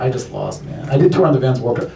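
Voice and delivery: usual speaking voice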